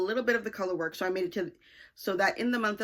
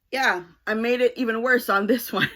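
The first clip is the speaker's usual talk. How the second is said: louder, breaking into a laugh at the end; 3 semitones higher, 7 dB louder than usual. Real, not acted. Knitter confessing a worse cable mistake, laughing. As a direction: own up with an amused laugh.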